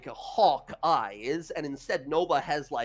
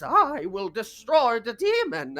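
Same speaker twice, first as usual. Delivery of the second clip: slow, higher character voice, drawn out; priest's battle vow; 5 semitones higher, 4 dB louder, slower than usual. Acted. Priest conjures an orb of radiance, grandly resolute. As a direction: declare it grandly as a put-on priest. Priest declares attack on demon.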